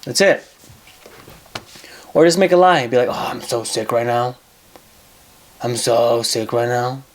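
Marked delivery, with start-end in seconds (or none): 3.1-7.0 fake sick voice. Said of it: "You hear this?".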